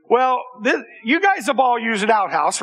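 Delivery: ordinary delivery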